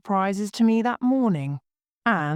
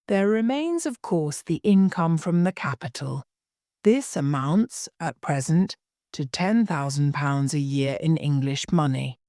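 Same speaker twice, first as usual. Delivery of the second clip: ordinary delivery, nothing marked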